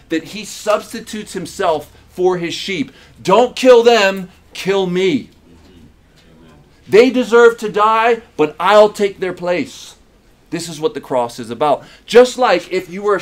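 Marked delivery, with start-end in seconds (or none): 3.2-9.9 pleading cry. Acted. Shepherd offering himself for sheep.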